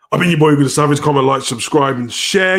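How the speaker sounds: loud, high sign-off to the viewers; hyped outro. Acted. Streamer signs off to the chat, loud and hyped. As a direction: hype up the like and subscribe.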